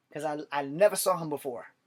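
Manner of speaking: ordinary delivery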